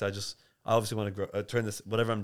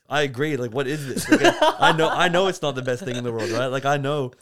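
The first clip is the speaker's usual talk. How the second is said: loud, higher-pitched retelling with laughter; amused, laughing reaction; 4 semitones higher, 10 dB louder than usual. Retold or imitated. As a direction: recount your own amused agreement, raised and loud. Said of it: "I'm laughing because I'm like".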